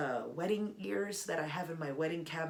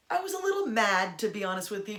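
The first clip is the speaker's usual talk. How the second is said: ordinary talk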